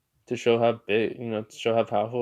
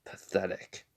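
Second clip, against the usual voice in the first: quiet contempt; 4 semitones lower, 9 dB quieter than usual. Retold or imitated. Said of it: "He just says".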